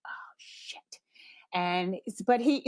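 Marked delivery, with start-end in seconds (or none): 0.1-1.0 whispered under the breath; whispered curse. Retold or imitated. Realizing mistake, said under breath.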